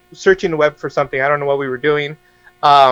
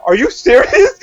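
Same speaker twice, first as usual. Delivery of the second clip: high incredulous question, near laughing; mock disbelief; 8 semitones higher, 4 dB louder than usual. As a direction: act out alarmed disbelief for laughs. Retells disbelieving reply to mom.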